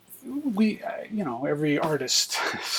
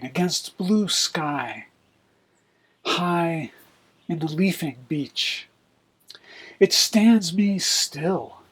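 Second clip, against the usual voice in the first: slow, louder poetry reading; reciting a poem; 2 semitones higher, 3 dB louder, slower than usual. Acted. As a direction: read it like a poet performing. Reads his poem aloud.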